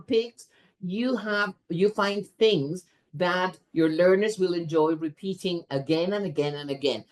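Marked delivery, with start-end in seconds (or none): none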